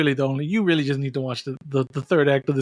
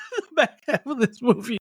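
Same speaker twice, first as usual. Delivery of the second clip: laughing; 8 semitones higher than usual. Real, not acted. Laughing joke.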